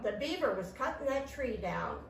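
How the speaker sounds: usual speaking voice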